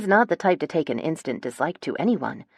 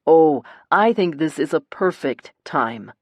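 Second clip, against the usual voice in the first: slow, louder, icy sarcasm; cold; 5 dB louder than usual. A narrator's reading. Brother refuses to back down, colder than ever. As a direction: draw it out coldly, leaning on the words. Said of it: "voice is colder than I've ever heard it".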